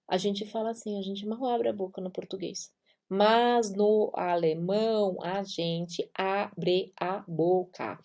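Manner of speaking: soft, mumbled with mouth nearly closed; mouth barely open. Shown as a demonstration. Teacher contrasting closed Portuguese and open German mouth, softly. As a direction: speak with a lazy jaw. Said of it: "abrindo a boca pra falar".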